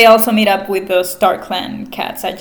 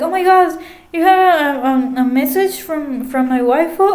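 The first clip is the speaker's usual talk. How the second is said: higher, giddy creepy fanboy; creepy obsessed Ashfur; 6 semitones higher, much faster than usual. Retold or imitated. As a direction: gush like a creepy obsessed fan. Ashfur gushes over Squirrelflight's message.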